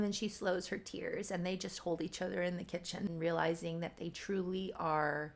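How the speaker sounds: ordinary delivery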